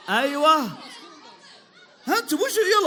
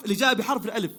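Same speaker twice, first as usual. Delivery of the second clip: usual speaking voice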